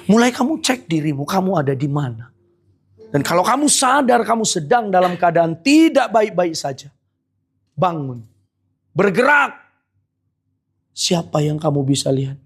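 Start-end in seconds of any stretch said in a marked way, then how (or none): 0.1-9.5 forceful preaching. Acted. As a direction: drive the rebuke home from the pulpit. Preaching call to action.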